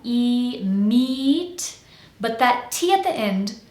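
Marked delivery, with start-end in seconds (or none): 0.0-1.8 "meat" with a stretched ee, rising higher; long vowel. Shown as a demonstration. Coach asks for long vowel, drawn-out meat. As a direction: hold "E", then "meat" with the same long vowel. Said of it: "make the vowel sound long".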